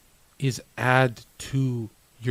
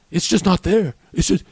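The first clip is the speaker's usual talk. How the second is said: usual speaking voice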